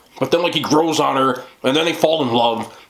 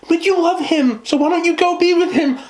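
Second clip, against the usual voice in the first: put-on much higher, pleading girlfriend voice; high-pitched best friend. Best friend urging the heroine to go to him, in a high voice. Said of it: "a secondary character who's like".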